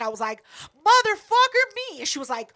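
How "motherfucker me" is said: high, loud brash retort; brash outburst. Retold or imitated. Answering Keela who asked who's asking, brash outburst. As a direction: throw it out big and high.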